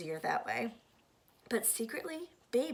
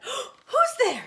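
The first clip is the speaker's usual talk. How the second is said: high, loud frightened call; startled; 11 dB louder than usual. Acted. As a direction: wake up scared and call out. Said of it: "she said".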